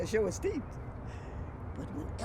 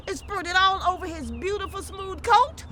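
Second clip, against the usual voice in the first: ordinary delivery, nothing marked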